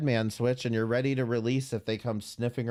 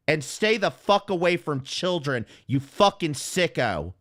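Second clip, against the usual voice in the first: furious; 2 semitones higher, 4 dB louder than usual. Real, not acted. Streamer telling Malice off for slandering him, furious. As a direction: hurl the insults at her. Angry callout rant at Malice.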